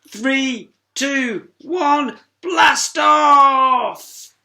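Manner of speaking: high, loud, building to a shout; countdown shout. Acted. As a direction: yell the final blast off.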